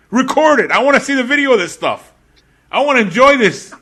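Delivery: high, loud, fast teasing; gleeful demand. Real, not acted. Teasing cohost about filming his reaction, gleeful yell.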